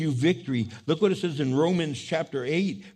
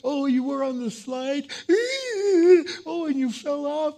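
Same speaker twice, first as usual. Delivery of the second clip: higher-pitched, concerned parent voice; mother soothing child; 8 semitones higher, 5 dB louder than usual. Acted. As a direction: coo sympathetically to a crying little one. Mother answers her crying child.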